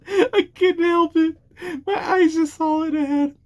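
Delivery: giggling helplessly at her own slip; high, crying-laughing voice; laughing to tears. Real, not acted. Laughing fit over a review.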